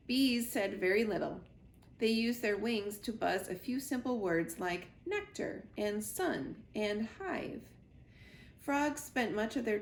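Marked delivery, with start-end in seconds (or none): none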